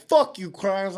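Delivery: mock-furious cursing; angry retort. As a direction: imitate an offended friend firing back. Acts out friend's angry retort.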